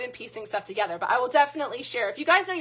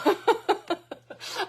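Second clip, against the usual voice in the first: high giggle; 10 semitones higher, 3 dB louder than usual. Real, not acted. Laughing at herself.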